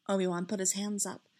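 plain reading voice

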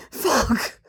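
sudden breath-caught exclamation; gasped curse. A narrator's reading. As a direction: gasp the curse out. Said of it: "he gasps out".

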